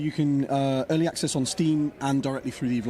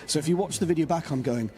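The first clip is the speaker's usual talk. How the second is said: ordinary talk, nothing marked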